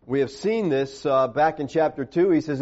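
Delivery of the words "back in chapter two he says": ordinary talk, nothing marked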